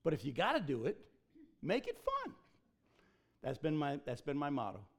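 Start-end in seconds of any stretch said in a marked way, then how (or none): 1.6-2.3 squeaky high, playful punchline; playful lift. Real, not acted. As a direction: toss the motto up lightly like a joke. Sermon motto punchline.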